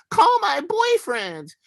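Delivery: high, slow, hammed-up half-sob; mock sob-scream. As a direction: drag it out like a fake wail. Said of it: "half sobbing, half screaming".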